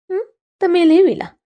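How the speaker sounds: high, amazed question; light up with girlish wonder; delighted surprise. Acted. Girl hearing they are fawns, surprised.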